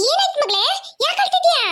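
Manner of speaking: high-pitched, worried questioning; acted motherly alarm, pitched high. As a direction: ask in rising panic about a crying child. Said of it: "ನಾನ್ ಏನೋ ಅನ್ಕೊಂಡು ಹೆದರ್ಕೊಂಡ್ಬಿಟ್ಟೆ".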